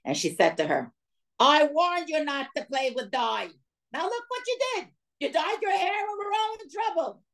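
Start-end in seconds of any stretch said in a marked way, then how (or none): 1.4-7.2 much higher, put-on scolding voice; mock-scold the little girl; scolding mother. Mother scolding her little girl in front of the conductor, much higher mock scold.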